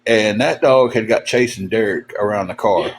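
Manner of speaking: normal talking voice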